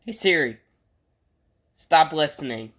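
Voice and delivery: ordinary talk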